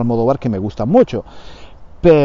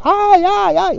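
high mock scream of terror; mock horror scream; slower than usual. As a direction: squeal like a victim in a horror film. (grito de terror fingido y agudo)

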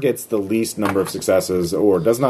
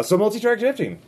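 excited; 12 semitones higher than usual. Acted. GM announcing dramatic move.

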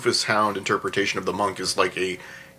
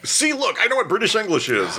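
far higher, proud showing-off voice; smug American writer; 11 semitones higher than usual. Retold or imitated. Mocking a show-off American writer, high smug voice. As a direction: crow it like you know it all. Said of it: "to, like".

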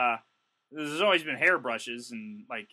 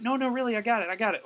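insistent customer; 10 semitones higher, faster than usual. Retold or imitated. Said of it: "she's like".

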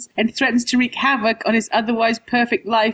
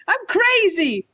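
mock bad actor; 9 semitones higher, slower than usual. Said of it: "who say the emotion that they're doing".

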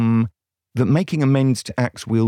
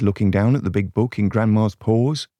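ordinary delivery, nothing marked

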